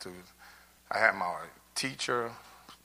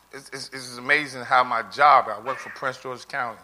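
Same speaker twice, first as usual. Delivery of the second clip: ordinary talk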